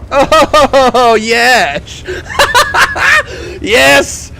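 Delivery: high, loud laughing yes; laughing cheer. Real, not acted. Gamer laughing with joy after another kill. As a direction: burst out laughing in delight.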